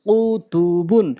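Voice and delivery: drawn-out syllable by syllable reading; slow demo. Shown as a demonstration. Teacher repeating the word for learners, slowly. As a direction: stretch each syllable for learners. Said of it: "প্রথমে আমি slow বলছি".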